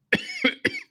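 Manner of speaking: harsh hacking cough; rough smoker's hack. Real, not acted. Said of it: "Smoker's cough".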